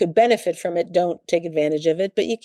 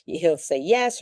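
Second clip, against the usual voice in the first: ordinary talk, nothing marked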